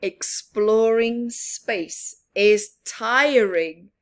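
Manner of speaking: ordinary delivery, nothing marked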